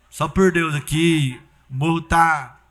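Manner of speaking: drawn out slowly, each word weighed; slow emphatic repeat. Retold or imitated. Church member drags out drug dealer's words, slow and emphatic. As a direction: lean on it, slow and firm.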